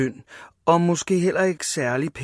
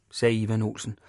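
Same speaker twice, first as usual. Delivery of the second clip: ordinary delivery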